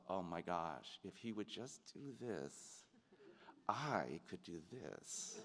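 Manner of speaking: hushed, low aside to oneself; slow, under-the-breath acted thought; private muttered thought. Actor privately judging a scene partner, hushed thought.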